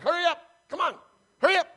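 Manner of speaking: high, loud barked urging; urgent, exasperated mock yelling; impatient driver. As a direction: imitate a driver lunging at the bumper. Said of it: "behind her like this".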